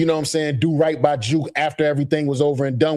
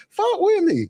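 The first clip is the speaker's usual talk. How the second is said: very high mock street hustler call. Podcaster imitating a street hustler, very high. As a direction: ham up a hustler begging to be put on. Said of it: "First thing you say is".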